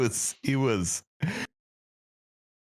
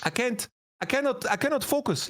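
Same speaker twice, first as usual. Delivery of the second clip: put-on Greek accent; 12 semitones higher than usual. Still voicing Forgiven's complaint.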